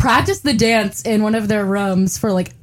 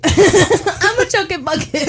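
laughing; 8 semitones higher, slower than usual. Real, not acted. Laughing at herself.

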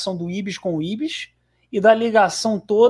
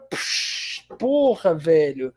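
ordinary talk

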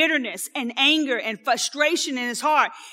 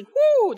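high woo; 12 semitones higher, 6 dB louder than usual. Real, not acted. Reacting to a burn.

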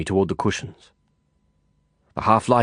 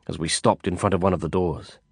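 usual speaking voice

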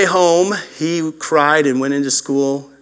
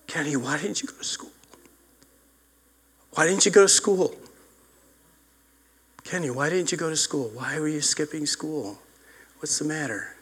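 soft, gentle father asking why, much softer; gentle fatherly questioning; 8 dB quieter than usual. Retold or imitated. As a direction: coax the boy softly like a kind dad. Father gently questions crying son.

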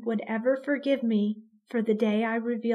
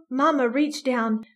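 plain reading voice

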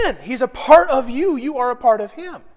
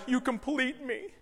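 softly quoted romantic line, lifting at the end; hushed mock-romantic movie quote; movie romance line; 12 dB quieter than usual. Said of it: "that scene from Jerry Maguire".

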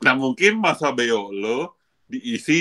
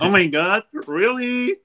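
put-on spoiled rich girl; 7 semitones higher than usual. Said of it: "spoiled brat orang-orang kaya".

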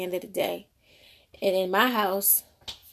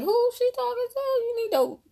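very high, shocked, indignant voice; hammed-up high scandalized gasp of disapproval; scandalized onlooker. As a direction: play the outraged aunties watching. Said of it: "we were like".

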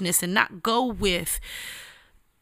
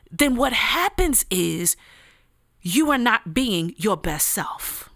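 usual speaking voice

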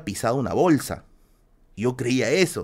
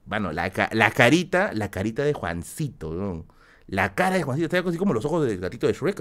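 usual speaking voice

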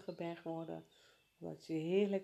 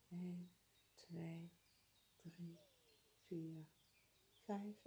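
much softer, slow counting; 10 dB quieter, much slower than usual. Acted. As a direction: count gently, almost whispering. Counting breaths softly.

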